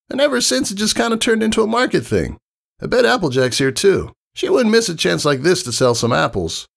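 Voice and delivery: high, cocky tomboy voice; high brash tomboy pony; brash pegasus. Acted. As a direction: play the confident young flyer explaining. Rainbow Dash voiced in story.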